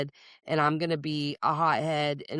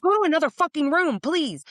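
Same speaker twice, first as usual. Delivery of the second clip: higher, louder mock yell; exasperated yell; 10 semitones higher, 5 dB louder than usual. Fed-up mom shouting at kids, half-joking mock yell.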